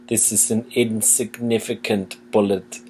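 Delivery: imitating Werner Herzog. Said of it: "he just goes".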